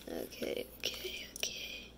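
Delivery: soft whispered okays; whispering. Real, not acted. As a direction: whisper it to yourself. Calming self while decorating.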